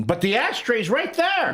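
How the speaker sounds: high, slow whine of protest; mock complaining. Host voicing smokers objecting to ban, complaining. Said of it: "you'd have smokers complaining".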